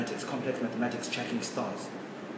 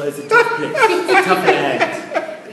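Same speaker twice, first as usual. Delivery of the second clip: laughing; 8 dB louder, much slower than usual. Real, not acted. Laughing while correcting himself.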